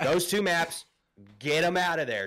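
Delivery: high, loud complaint; emphatic shout. Real, not acted. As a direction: yell it out in frustration. Demanding maps be removed.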